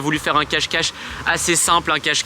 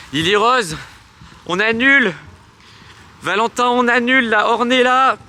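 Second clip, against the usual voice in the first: high loud call across the park; 8 semitones higher, 4 dB louder than usual. Real, not acted. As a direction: shout the names out. Calling off game across park.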